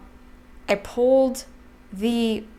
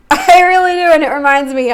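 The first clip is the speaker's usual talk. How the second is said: laughing; 5 semitones higher, 12 dB louder than usual. Real, not acted. Laughs while insisting.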